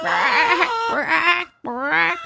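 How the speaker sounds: high, strained mock hen squawks; imitated chicken squawk. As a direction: squeal it like a laying hen. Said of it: "It's like".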